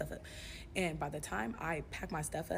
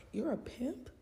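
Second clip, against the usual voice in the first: ordinary delivery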